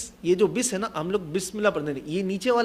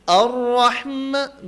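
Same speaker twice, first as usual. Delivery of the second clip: slow, louder chanted الرحمن; recitation for students to repeat; 4 semitones higher, 5 dB louder, much slower than usual. Shown as a demonstration. Teacher giving the class a line to repeat, slow chant. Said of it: "بولے".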